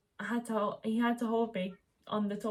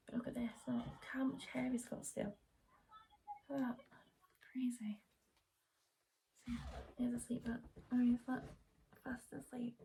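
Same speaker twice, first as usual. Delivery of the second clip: whisper; 4 semitones higher, 8 dB quieter than usual. Real, not acted. Mother showing viewers her sleeping newborn, whispering. Baby asleep beside her.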